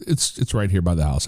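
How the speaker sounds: ordinary talk, nothing marked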